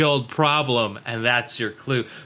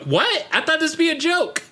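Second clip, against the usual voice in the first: much higher, incredulous protest; baffled disbelief; 13 semitones higher than usual. Retold or imitated. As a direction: pitch jumps up in mock bafflement. Retold baffled reaction to the episode.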